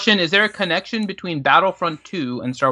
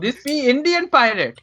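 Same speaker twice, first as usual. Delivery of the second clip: put-on higher-pitched Indian-accented pirate; 6 semitones higher than usual. Pirate corrects the crew about himself, Indian accent. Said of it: "sounds like an Indian accent".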